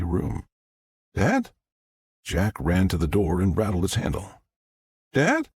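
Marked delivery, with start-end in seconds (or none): 1.1-1.5 high, questioning call; anxious calling. Acted. Son finds the room empty, calling anxiously. 5.1-5.5 very high, rising call; alarmed calling. Acted. Son at a locked door, calling in alarm. Son trapped, searching for father.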